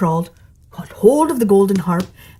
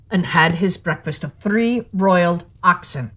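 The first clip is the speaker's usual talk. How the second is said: ordinary delivery, nothing marked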